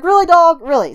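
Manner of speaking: exasperated. Retold or imitated. Dog ignores plea for help.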